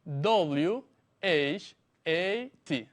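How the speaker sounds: slow, letter-by-letter spelling. Shown as a demonstration. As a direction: spell it slowly for someone writing it down. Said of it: "se escribe".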